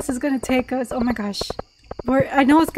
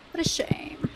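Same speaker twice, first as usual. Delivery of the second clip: whisper; 10 dB quieter than usual. Real, not acted. Mock sympathy, whispered aside.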